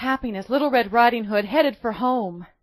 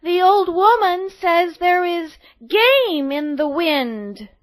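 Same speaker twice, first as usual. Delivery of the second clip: put-on high, louder, slow girl voice; 7 semitones higher, 7 dB louder, slower than usual. A narrator's reading. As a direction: deliver the message like a small girl. Girl relays message.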